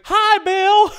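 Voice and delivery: put-on very high, loud old lady hello; old neighbour greeting the host, squeaky and loud; quirky old lady. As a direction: squeal it like a delighted old woman.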